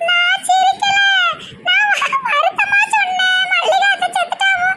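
shrieky high, slowed protest; laughing. Acted. Daughter insisting to mother that she is sad, laughing. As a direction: deny laughing while the giggles break out. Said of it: "சும்மா சும்மா சிரிக்க".

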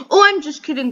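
loud, high teasing reveal; spring the joke on the viewers; bursting out. Real, not acted. Reverses the fake quitting joke.

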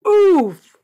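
high, loud falling "oof"; triumphant whoop. Real, not acted. Player celebrates six win streak, triumphant whoop. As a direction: let out a big high whoop.

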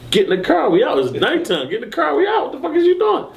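high-pitched, insistent urging; agitated quote, pitch climbing; urgent retold order. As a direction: hurry them along in a rising pitch. Urging passenger into car.

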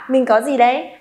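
ordinary talk, nothing marked

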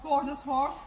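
high, soft pet-talk voice; soft high sing-song pet talk; cooing to a horse. Real, not acted.

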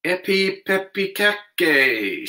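slow, high chanted spell; magic incantation. Acted. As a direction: intone it like a spell. Reciting the magic charm.

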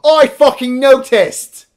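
outraged. Real, not acted. Outraged at sloppy film scene.

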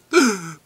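sharp loud mock gasp; dramatic gasp. Vlogger pretending pity for his lonely corner, dramatic gasp.

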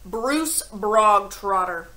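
loud, slow bark of a name. A narrator's reading. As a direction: bark it out like a drill sergeant. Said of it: "the Trunchbull barked suddenly".

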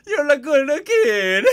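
slow, loud, high cartoon Italian; hammed-up Italian voice; Italian accent. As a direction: stretch it out in a squealing Italian caricature. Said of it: "How do you do an Italian voice?".

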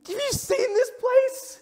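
imitating Pastor Josh's delighted shout; excited shout. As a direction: shout it like a thrilled friend. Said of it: "And he goes".

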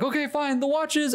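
high, panicked giving in; high wife voice. Retold or imitated. Wife finally revealing the watch, high panicked voice. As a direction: cave in with a squeak. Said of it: "the woman is like".

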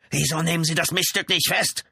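high, indignant outburst; angry demand. A narrator's reading. Brother demands police arrest the woman, indignantly. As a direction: demand it hotly, voice pushed up.

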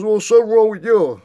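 high, loud mock of the brother's comeback; hammed-up imitation of a brother snapping back. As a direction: throw it back at him in a silly put-on voice. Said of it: "He goes".

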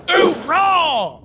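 barking military Hoorah. Acted. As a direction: shout it like a drill sergeant. Military cheer.

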